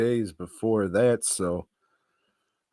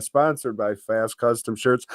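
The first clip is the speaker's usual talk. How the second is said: usual speaking voice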